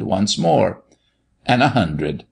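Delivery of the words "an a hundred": usual speaking voice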